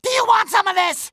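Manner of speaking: high, quick challenge; shouted taunt. Acted. Hero dares evil toys to fight, shouted taunt.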